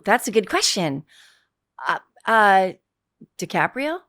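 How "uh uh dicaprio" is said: unsure. Real, not acted. Actress naming most famous person in her phone, unsure. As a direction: offer the name as if not even sure. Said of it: "you're not even sure".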